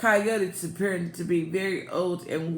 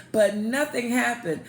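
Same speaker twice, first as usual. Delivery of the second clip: plain reading voice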